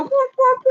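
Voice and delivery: very high squeaky squawk; playful hen noises for kids; chicken squawks. Acted. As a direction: imitate panicked hens flapping. Chickens started squawking and flying around.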